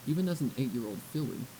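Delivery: plain reading voice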